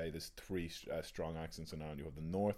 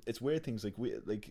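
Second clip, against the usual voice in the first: usual speaking voice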